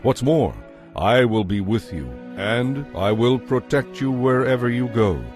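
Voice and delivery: normal talking voice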